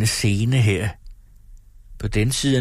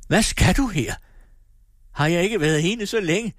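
higher, menacing cry; threatening shout; 10 semitones higher than usual. Acted. Wife to husband, threatening him away, high. Lina rejects husband bitterly.

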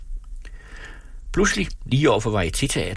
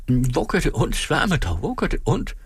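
rising alarm; 7 semitones higher than usual. A narrator's reading. Repeats question to woman in pain.

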